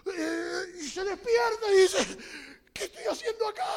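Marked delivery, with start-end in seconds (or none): 2.7-3.6 much higher, alarmed; startled question. Acted. Man waking from the dream, alarmed and high. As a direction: wake up in a panic.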